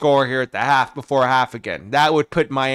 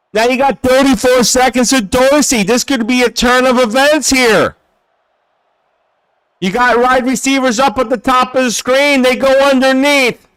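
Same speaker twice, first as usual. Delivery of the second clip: higher-pitched, driving commentary; live play-by-play; 7 semitones higher, 7 dB louder than usual. Real, not acted. Streamer calling the last seconds before half, urgent play-by-play. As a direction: push the call forward with urgency.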